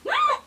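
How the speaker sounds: sudden high shriek of fright; startled scream. Real, not acted. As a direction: yelp in real shock. Spider startles her.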